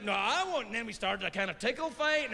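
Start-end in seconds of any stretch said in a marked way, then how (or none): 0.0-0.7 high bratty reply; sing-song defiance like a kid; mock childish; childish retort.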